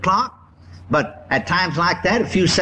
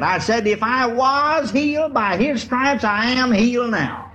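raised, ringing declaration of faith; defiant sermon delivery, higher than talk; emphatic preaching; 6 semitones higher than usual. Retold or imitated. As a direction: preach it high, leaning on key words. Preaches his retort to the devil.

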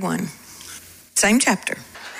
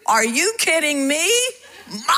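higher, rising disbelief; incredulous; 5 semitones higher than usual. Acted. Preacher voicing Paul's reply to Corinth, incredulous. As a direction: exclaim it as an astonished retort.

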